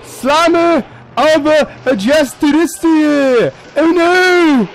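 high, long-drawn battle cry; hammed-up holler. Player announcing his hammer attack, high drawn-out holler. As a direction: stretch it out high into a mock wail.

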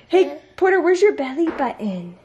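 put-on high, sing-song baby talk; talking to a toddler. Mom asking toddler about body parts.

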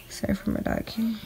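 normal talking voice

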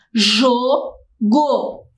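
"jogo" drawn out with a gap after "jo"; split into syllables. Shown as a demonstration. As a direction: say "jogo" slowly, one syllable at a time. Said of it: "se nós separarmos em sílabas". ("jogo" bem devagar, sílaba por sílaba)

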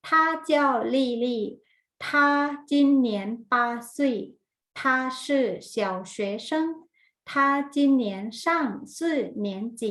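slow, word-by-word language drill; teacher modelling sentences. Shown as a demonstration. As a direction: say each word separately, with long gaps. Models Chinese sentences for repetition.

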